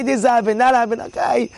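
ordinary talk

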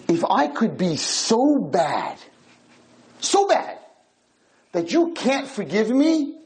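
slow, stretched, rising plea; pleading reasoning with God. Retold or imitated. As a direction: drag out so bad to press the point. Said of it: "He said".

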